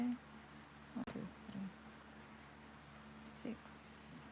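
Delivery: whispered counting; counting under breath. Real, not acted. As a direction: count in a soft whisper to yourself. Counting stitches aloud mid-lesson.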